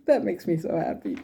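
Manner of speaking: said through a real laugh; giggling with happy relief; laughing happily. Real, not acted. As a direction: laugh the words out with joy. Laughing at grandmother's good news.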